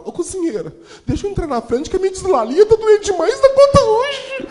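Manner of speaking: put-on high, pleading child voice; whiny kid. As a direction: beg like a sly kid faking sick. Kid begging cook to cut line.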